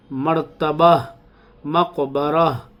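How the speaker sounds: मर्तबा and मकबरा slowed, with the final ha pushed out extra; exaggerated final ha. Shown as a demonstration. Teacher exaggerating the ending so pupils can practise. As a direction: overdo the ha at the end of मर्तबा and मकबरा. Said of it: "मैं थोड़ा सा हा ज़्यादा निकाल रहा हूँ".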